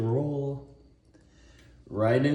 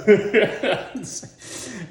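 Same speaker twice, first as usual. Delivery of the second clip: high, loud laugh at his own bit; burst of laughter; 10 semitones higher, 4 dB louder than usual. Real, not acted. Cracking up after mocking the Rocky line. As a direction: crack up at the joke.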